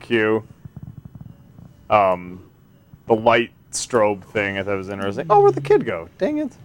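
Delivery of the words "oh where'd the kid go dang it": high startled cry; sudden high alarm; surprised yelp. Real, not acted. Losing the kid in game.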